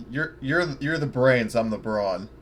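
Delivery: ordinary talk, nothing marked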